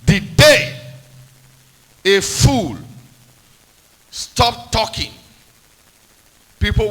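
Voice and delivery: loud preaching. Real, not acted. Preacher building a proverb.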